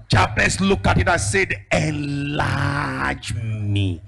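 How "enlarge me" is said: slow drawn prayer. Retold or imitated.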